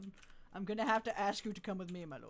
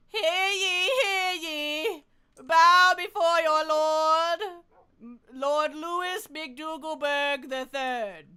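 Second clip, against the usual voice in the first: loud, higher, slow town crier call; herald proclamation; 7 semitones higher, 12 dB louder, much slower than usual. Pretend. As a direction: announce the lord like a royal herald. Herald announcing the lord.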